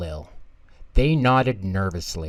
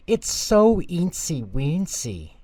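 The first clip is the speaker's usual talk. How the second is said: put-on slow, higher sweet baby talk; cute toddler voice; 8 semitones higher, 3 dB louder, slower than usual. A narrator's reading. Tommy cooing over a spider, slow high baby talk. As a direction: marvel at a tiny spider.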